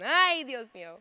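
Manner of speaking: loud, high Spanish mom yell; yelling at kids. Retold or imitated. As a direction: yell it at the kids across the house. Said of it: "that's what I yell at my kids".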